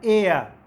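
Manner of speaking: एयर loud, eh gliding to uh; teacher modelling the air sound; diphthong demo. Shown as a demonstration. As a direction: stretch एयर from eh into uh. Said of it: "वो है".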